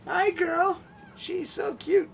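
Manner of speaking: high, soft cooing to a dog; pet talk. Real, not acted. Host greeting Rogue the dog, cooing high. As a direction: coo in a tiny high voice.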